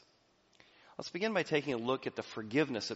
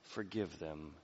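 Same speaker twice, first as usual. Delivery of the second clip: soft, lower, tender plea; gentle prayer; 5 semitones lower, 9 dB quieter than usual. Acted. Jesus on the cross forgiving, soft and lower. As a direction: murmur it like a dying man's prayer. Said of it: "When he's spit upon".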